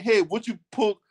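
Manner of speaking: imitating stilted politeness with mocking hesitation; mock polite request. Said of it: "We not saying no shit like that".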